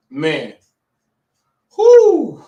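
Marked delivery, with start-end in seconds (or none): 1.8-2.4 a sudden high whoop, shouted out loud. Real, not acted. A shaken dad lets out a loud high whoop. Shaken after dog attack.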